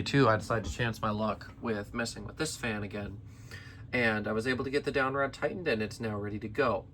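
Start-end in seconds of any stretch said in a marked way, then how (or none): none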